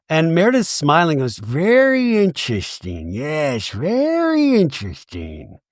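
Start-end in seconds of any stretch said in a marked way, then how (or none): 1.4-5.6 slow, slurred mock relish; drawn-out hammed-up mimicry; smug imitation. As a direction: stretch it out, relishing every syllable. Imitating Meredith's smug reply.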